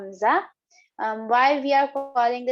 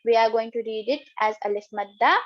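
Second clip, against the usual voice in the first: normal talking voice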